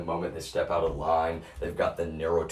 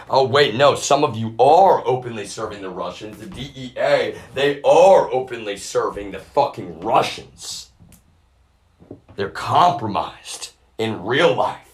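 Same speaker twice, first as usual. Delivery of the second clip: loud, higher, hammering ARE as confirmation; heated accusation; 5 semitones higher, 8 dB louder than usual. Real, not acted. Speaker accusing people of openly serving the Russians, heated and loud. Angry realization answering his own question.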